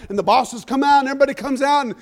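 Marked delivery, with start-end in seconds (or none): none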